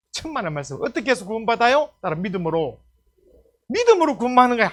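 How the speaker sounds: ordinary talk, nothing marked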